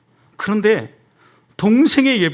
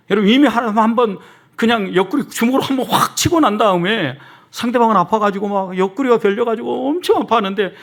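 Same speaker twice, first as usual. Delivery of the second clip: high, driving sermon illustration; emphatic, raised pulpit voice; forceful preaching; 7 semitones higher, 5 dB louder than usual. Real, not acted. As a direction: preach it with vigor.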